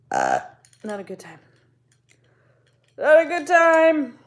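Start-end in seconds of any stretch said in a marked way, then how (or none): 3.0-4.2 much higher, louder, slow and drawn out; mock dramatic wail. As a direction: wail it like a melodramatic complaint. Repeats phrase, second time exaggerated.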